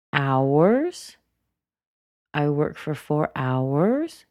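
"hours" swooping up each time; drawn-out rising "hours"; rising intonation. Shown as a demonstration. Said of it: "She's continuing on".

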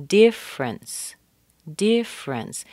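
"difference" as two syllables, DIFF-rence, first one high; missing syllable. Shown as a demonstration. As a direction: drop the middle E of "difference". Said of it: "we're missing a syllable here".

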